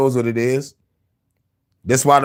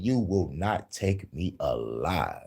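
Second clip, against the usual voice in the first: low defiant vow; 6 semitones lower, 9 dB quieter, much slower than usual. Real, not acted.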